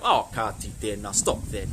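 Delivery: mock "oh, stop that". Shown as a demonstration. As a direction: act out a low "oh, stop that". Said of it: "you could use it in a bit of a".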